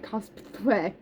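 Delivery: COSPLAY stretched out and garbled; COSPLAY as a drawn-out tongue slip; stumbled word. Retold or imitated. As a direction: trip slowly over COSPLAY, halfway from costume. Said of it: "I wanted to say costume".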